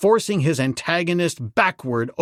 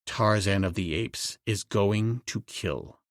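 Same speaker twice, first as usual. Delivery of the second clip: low threat; 6 semitones lower, 7 dB quieter than usual. Acted. Tarzan's low threat continues.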